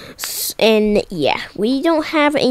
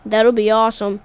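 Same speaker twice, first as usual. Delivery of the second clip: ordinary talk, nothing marked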